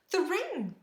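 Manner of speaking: high-pitched, startled exclamation; near scream. A narrator's reading. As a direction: exclaim just short of screaming. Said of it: "Maria nearly screamed".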